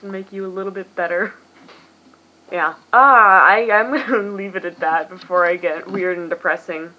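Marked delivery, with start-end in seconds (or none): none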